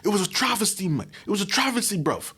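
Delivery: high, fast London roadman voice mocking an actor; mock London accent. Imitating a British actor complaining to a friend, in a London accent.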